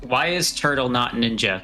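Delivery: ordinary talk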